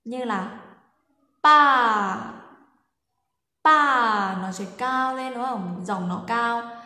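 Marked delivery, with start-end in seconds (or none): 1.4-2.2 "pa" very loud and high; high level tone. Shown as a demonstration. Teacher shows what high and level means. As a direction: belt "pa" high and straight. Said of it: "Cao thẳng là gì ạ".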